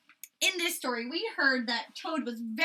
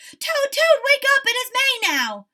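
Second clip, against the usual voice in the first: very high, loud wake-up call; excited shouting; 14 dB louder than usual. A narrator's reading. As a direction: shout him awake with joy. Frog waking Toad.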